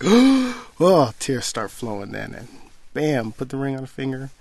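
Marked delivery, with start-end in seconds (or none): 0.0-1.1 sudden high, loud oh; imitating the fiancée's shocked oh at the proposal; surprised gasp. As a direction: gasp it as the ring appears.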